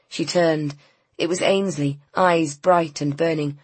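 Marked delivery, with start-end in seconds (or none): none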